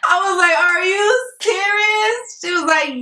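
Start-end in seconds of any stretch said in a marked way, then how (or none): 0.6-2.4 high, slow, tearful shout; crying with joy. Retold or imitated. To her realtor after the news, a tearful high shout. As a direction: let the joy break into a cry. Overjoyed near tears.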